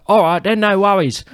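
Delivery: high, loud Cockney impression; mock Cockney, squeaky-high and breezy. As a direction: imitate a chirpy Cockney, pitched right up. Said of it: "This Cockney English guy?".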